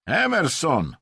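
high-pitched impatient call; impatient appeal. A narrator's reading. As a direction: call him out, fed up. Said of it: "zaapelował zniecierpliwiony Rosjanin". (zniecierpliwione wołanie)

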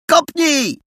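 wysoki, ostry okrzyk. A narrator's reading. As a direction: krzyknij nagle i wysoko. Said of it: "krzyknął Adaś". (wysoki okrzyk Adasia)

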